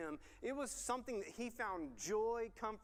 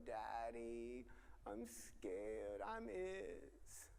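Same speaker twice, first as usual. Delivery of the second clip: soft imitation of a frightened little boy; scared toddler; 7 semitones lower, 6 dB quieter, much slower than usual. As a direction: mimic a small child's timid plea, softly. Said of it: "and he would go".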